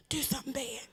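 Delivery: whispered creepy chant, very soft; spooky whisper. Retold or imitated. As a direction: breathe it like a ghostly voice.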